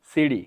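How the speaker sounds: সিটি said as "si-di"; সিটি with D instead of T; American D sound. Shown as a demonstration. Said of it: "ড উচ্চারণ করে".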